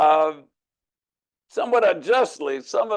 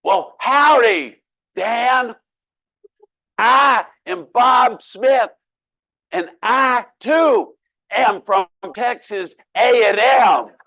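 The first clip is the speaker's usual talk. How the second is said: higher, louder and slow, every word spelled out; slow loud reply; 8 semitones higher, 7 dB louder, much slower than usual. Retold or imitated. Seatmate Bob answers Dan, loud, high and slow. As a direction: shout it slowly like talking to a foreigner.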